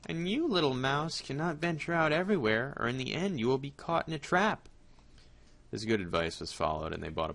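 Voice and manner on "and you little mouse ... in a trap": sly cat. Acted. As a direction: play the cunning cat planning ahead. Cat warns mouse.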